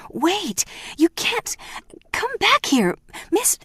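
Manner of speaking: desperate shout after the departing woman; frantic call. Acted. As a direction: call her back in panic. Housekeeper calls after leaving mother.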